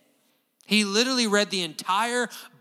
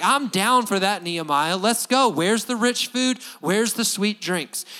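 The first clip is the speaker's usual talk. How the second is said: eager reply; 4 semitones higher, 7 dB louder than usual. Retold or imitated. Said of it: "I'm like".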